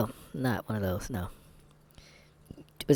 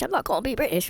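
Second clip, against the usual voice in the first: mock British accent; 5 dB louder, faster than usual. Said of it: "not like British".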